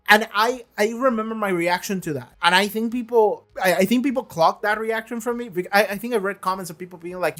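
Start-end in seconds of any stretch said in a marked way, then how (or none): none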